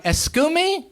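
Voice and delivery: high-pitched Spanish-accented excuse me. Shown as a demonstration. Spanish speaker who doesn't understand, puzzled Spanish accent. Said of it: "because you speak Spanish".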